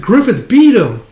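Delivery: high, loud cheer; root loudly for your champion; acted cheering yell. Band cheers as leader wins the duel, excited yell.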